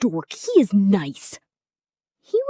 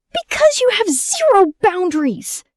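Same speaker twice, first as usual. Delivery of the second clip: yelling while running away; shouting; 9 semitones higher, 6 dB louder than usual. Real, not acted. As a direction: shout it back over your shoulder. Running from sister, yelling back.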